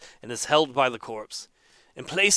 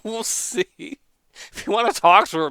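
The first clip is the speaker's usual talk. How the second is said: man doing a woman's voice; 6 semitones higher, 4 dB louder than usual. Acted. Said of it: "could I please have that voice".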